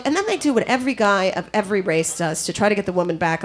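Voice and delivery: normal talking voice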